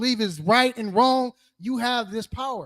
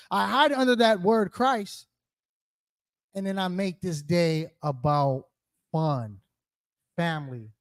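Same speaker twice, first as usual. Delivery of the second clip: usual speaking voice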